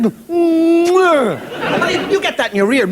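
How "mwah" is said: loud hammed-up air kiss. Right in your ear, loud mock air kiss. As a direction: blow a big loud kiss sound. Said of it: "They just did it".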